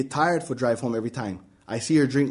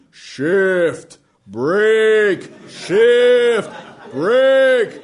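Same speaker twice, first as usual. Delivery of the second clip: dad barking orders, higher and much louder; screaming dad; 8 semitones higher, 5 dB louder, much slower than usual. Acted. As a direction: yell every order into the kid's ear. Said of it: "like a giant screaming in my ear".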